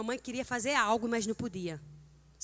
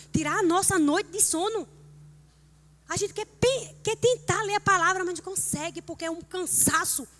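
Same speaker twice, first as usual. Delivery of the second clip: higher, louder sermon build-up; fervent preaching; 8 semitones higher, 5 dB louder than usual. Acted. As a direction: preach it to the congregation with fire. Preaching at rising force.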